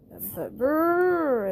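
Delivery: slow, drawn-out cold shiver; shivering brrr. Acted. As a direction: shudder at the icy water. Too cold to swim.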